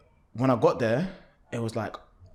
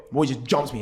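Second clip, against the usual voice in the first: usual speaking voice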